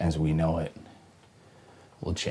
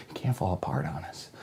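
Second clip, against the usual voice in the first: ordinary delivery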